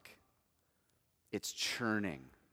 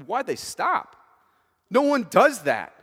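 normal talking voice

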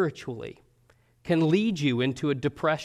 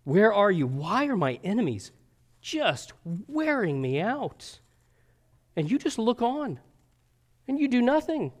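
higher, pleading complaint climbing to a near wail; preacher acting out the psalmist's despairing plea; anguished lament; 6 semitones higher than usual. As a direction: voice a worn-out sufferer accusing God. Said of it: "who lacks assurance right now".

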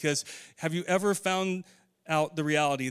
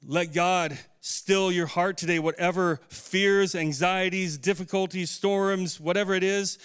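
fervent appeal; 3 semitones higher, 3 dB louder than usual. Acted. Exhorts congregation about fears.

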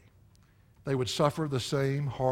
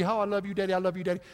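higher, lightly voiced adoring daughter; a hint of a doting child; sweet little girl; 4 semitones higher, much faster than usual. Father imitating sweet daughter, higher =37. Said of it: "here's this little sweetie".

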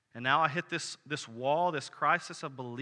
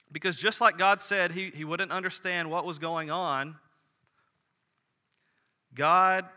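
louder, raised preaching voice driving the point home; fervent preaching; 4 semitones higher, 6 dB louder than usual. Acted. Preacher to congregation, retelling Habakkuk's struggle, in a loud, fervent preaching voice. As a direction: build the sermon to a ringing climax. Preacher builds to full force.

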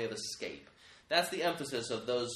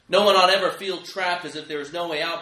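rally the hearers with force; loud assurance; 3 semitones higher, 10 dB louder than usual. Real, not acted. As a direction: swell up loudly on no one ought ever feel trapped. Climactic sermon assurance.

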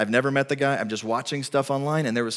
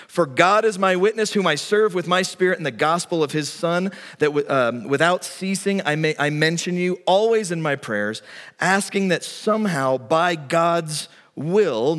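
raised, ringing scripture reading, higher and louder; preaching scripture; 5 semitones higher, 4 dB louder than usual. Acted. Preacher reading Paul's letter to the Romans, ringing and raised. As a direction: proclaim Paul's words with full force.

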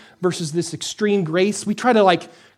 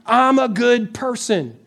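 smug self-assurance; 5 semitones higher than usual. Retold or imitated.

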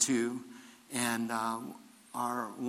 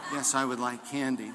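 chuckling reply; laughing through it; 3 dB quieter than usual. Real, not acted. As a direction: answer while laughing.

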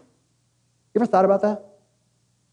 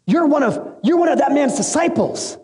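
lightly voiced servant girl, pitched up; acted accusation; 7 semitones higher than usual. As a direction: play a servant spotting and accusing someone.

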